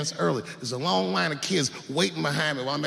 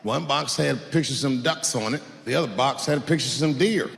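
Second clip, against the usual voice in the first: usual speaking voice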